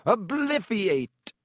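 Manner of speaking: high, drawn-out spell cry; triumphant high-pitched yell. A narrator's reading. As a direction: yell it as an incantation, stretching it out.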